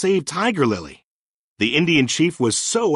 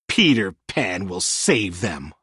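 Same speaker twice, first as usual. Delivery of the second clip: mocking; 7 semitones lower, slower than usual. A narrator's reading. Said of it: "laughing with Smee".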